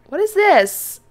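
high, loud shocked cry. Real, not acted. Vlogger startled by the screen, loud high cry. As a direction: blurt it out startled.

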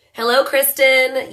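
high, loud and slow, drawn out; sing-song greeting. Acted. Vlogger greeting a friend, drawn-out sing-song hello. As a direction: stretch the hello up high like a cheery call.

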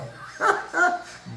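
a quick airy laugh at his own joke; high breathy laugh. Real, not acted. Pastor laughing at his toilet seat joke, amused. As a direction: laugh it off lightly.

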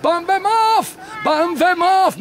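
high shrieking battle cry. Acted. Jester voicing soldiers on horseback, shrieking war cry. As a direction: scream it like a mad horseman charging.

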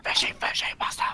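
hushed, voiceless chant; playful whisper. Pretend. Player teasing friends with nonsense, playful whisper. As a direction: whisper it under the others' chatter.

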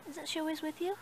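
usual speaking voice

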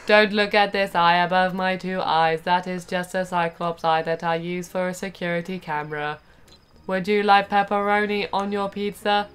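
robotic small talk on one note; monotone robot. Pretend. Pizza man robot offering pepperoni, monotone robot voice. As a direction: play a pizza robot, every word even. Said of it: "epic small talk in a monotone voice".

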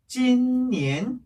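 "찐니엔" with long level "찐", then rising "니엔"; first-second tone. Shown as a demonstration. Teacher joining the syllables, "찐니엔" level then rising. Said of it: "일 성, 이 성일 때는".

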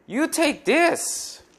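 "You take this." slow, pitched high, with a big rise on "take"; intonation shows annoyance. Shown as a demonstration. Teacher shows English carrying annoyance by tone, high rising. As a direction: lean on "take" and swing the tune way up. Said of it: "억양으로 이거를 해결을 합니다".

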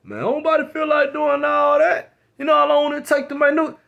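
high, loud, whining excuse; mock indignant protest. Man excusing himself from cooking, mock indignant protest. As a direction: protest loudly as the lazy boyfriend. Retold man arguing back.

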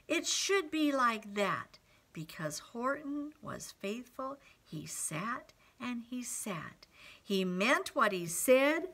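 put-on story read to children. A narrator's reading. Reads gentle ending to children.

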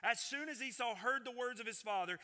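high, intense Bible narration; dramatic scripture reading. A narrator's reading. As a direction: read the story with rising urgency.

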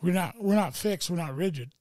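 usual speaking voice

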